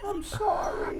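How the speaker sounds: giggling apology, high; laughing. Real, not acted. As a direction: laughs through the words. Laughing at listener's question.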